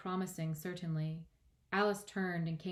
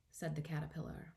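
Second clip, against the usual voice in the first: plain reading voice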